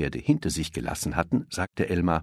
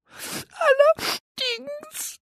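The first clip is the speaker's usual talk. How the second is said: very high, slow drawn-out whine; whiny little elephant; much slower than usual. A narrator's reading. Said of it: "jammerte der kleine Elefant".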